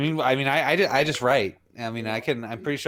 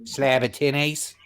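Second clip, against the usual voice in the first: broad Aussie slang delivery; Australian accent. Acted. Co-host trading Aussie slang, broad Australian accent. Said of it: "an Australian accent".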